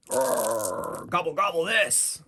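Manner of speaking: roar, then gobble it out high; snarling battle cry. Acted. Player attacks as goblin voice.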